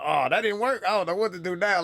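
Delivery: mock panicked thinking aloud; retold panic. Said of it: "I panicked".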